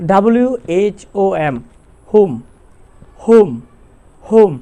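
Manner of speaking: ordinary talk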